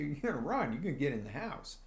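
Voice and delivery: normal talking voice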